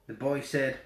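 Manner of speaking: ordinary delivery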